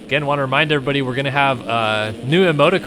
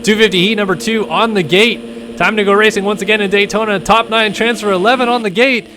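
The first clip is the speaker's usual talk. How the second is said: live play-by-play; 6 semitones higher than usual. Acted. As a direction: call the race with rising excitement. Excited race play-by-play.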